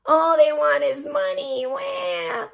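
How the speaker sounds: whiny, high-rising wail; hammed-up boo-hoo, drawn out at the end; mock crying. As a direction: imitate a crybaby wailing. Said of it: "then he starts crying about women".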